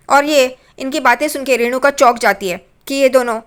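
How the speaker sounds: usual speaking voice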